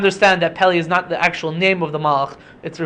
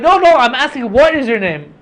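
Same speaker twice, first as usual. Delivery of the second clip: insistent; 6 semitones higher, 3 dB louder than usual. Retold or imitated. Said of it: "he was like".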